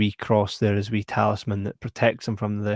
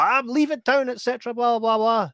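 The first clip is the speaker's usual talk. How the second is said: mock villain, high; 11 semitones higher than usual. Villain voiced by the teller.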